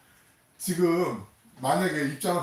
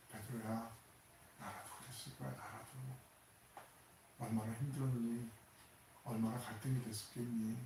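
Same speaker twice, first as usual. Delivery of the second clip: tender voice of Jesus; 3 semitones lower, 13 dB quieter than usual. Acted. Jesus consoling Peter after denial, tender hush. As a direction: comfort him as if he were about to break. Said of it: "지금 이 시선으로 바라보시는 단어가 엠블레프라는 단어예요".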